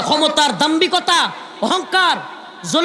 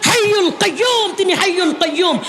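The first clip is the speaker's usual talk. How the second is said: full-force preaching; 6 semitones higher than usual. Acted. Preacher roaring Quran verses to the crowd. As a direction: thunder each verse at the crowd.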